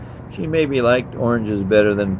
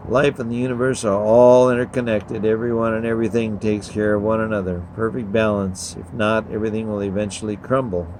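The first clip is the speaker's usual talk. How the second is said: ordinary delivery, nothing marked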